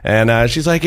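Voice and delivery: ordinary talk, nothing marked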